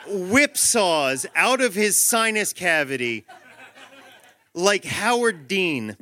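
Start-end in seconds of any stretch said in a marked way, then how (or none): none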